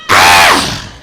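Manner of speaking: 2 low, loud hammed-up scream; 3 yelp like you just got caught off guard; 1 friend's scream. 4 friend finding the mouse in the kitchen, low mock scream. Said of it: "I hear him scream".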